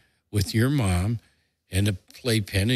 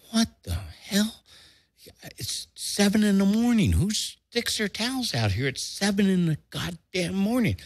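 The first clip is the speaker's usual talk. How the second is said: 1 incredulous grumble; 11 semitones higher than usual. Retold or imitated. Grumbling at towels on beach.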